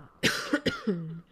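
loud hacking cough; real coughing fit. Real, not acted. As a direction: cough hard from allergies. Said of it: "Sorry, I'm coughing a little bit".

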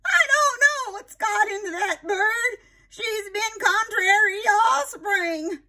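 very high, slow fussing aunt; aunt scolding her noisy parrot, high and fretful; exasperated Aunt Sarah. A narrator's reading. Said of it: "said Aunt Sarah".

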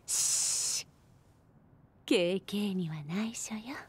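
low hushed whisper; secret whisper. Acted. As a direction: shush him and keep it secret. Shushing, keeping a secret. (内緒話のささやき)

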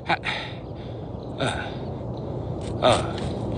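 ordinary talk, nothing marked

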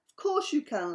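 high, loud, amused agreement; laughing assent. A narrator's reading. Buster lets Sam borrow a book, laughing. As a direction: agree with a light storybook merriment. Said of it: "laughed Buster".

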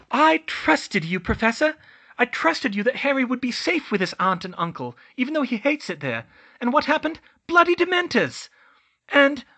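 loud, high, furious accusation; screaming at headmaster. A narrator's reading. Hermione accusing Dumbledore of failing Harry, furious high shout. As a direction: shriek your betrayal at him, high and loud. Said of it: "She was screaming at her headmaster".

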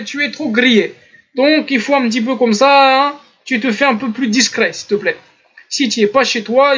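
donneur de leçons parodié, voix un peu plus haute; ton d'humour, imitation moqueuse. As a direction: imiter avec humour celui qui prie de rester discret. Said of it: "je vous la fais avec un ton d'humour". (sermon parodié sur un ton humoristique)

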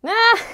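high loud squeal of delight. Real, not acted. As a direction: squeal at something cute. Squeal at hair clips.

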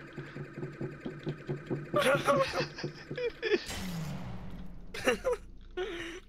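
high, crying laugh; hysterical laughter. Real, not acted. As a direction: laugh till near tears. Hysterical after scare.

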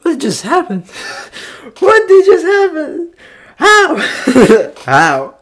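very high, loud, half laughing and half crying; overwhelmed to tears and laughing; reacting to the album's end in tears. Real, not acted. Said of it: "I'm not gonna cry".

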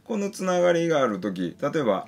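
ordinary talk

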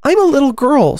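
put-on high, loud child character voice; high-pitched, mildly doubtful claim. A narrator's reading. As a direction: answer as an unsure storybook girl. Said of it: "rather doubtfully".

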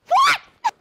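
huge high shocked yell of what; shouted what. Acted. Caught off guard by the founder question, yelling in shock. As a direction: blurt it out, scandalized.

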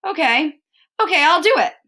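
high, exasperated acceptance; giving in. A narrator's reading. Cornered boy gives in to dare.

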